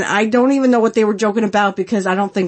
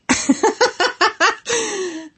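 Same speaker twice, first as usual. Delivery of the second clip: laughing; 12 semitones higher than usual. Real, not acted. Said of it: "laughs at her own joke".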